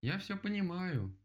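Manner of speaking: high, slow, drawn-out call; loud agreement. A narrator's reading. Bear calls up to the woodpecker, high slow agreement. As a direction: stretch it out, calling upward. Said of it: "громко согласилась Мишка".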